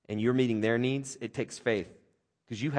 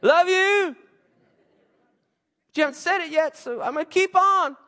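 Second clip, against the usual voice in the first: put-on much higher, louder little-boy voice; son Levi's voice; 8 dB louder than usual. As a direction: squeak it like the four-year-old. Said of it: "Levi talks like that normally".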